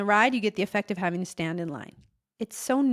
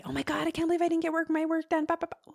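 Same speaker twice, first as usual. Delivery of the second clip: higher, fast mock whining; mock whine; 8 semitones higher, faster than usual. Herself after the thrift store, fast mock whining. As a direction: parody your own fussing. Mocking herself missing work.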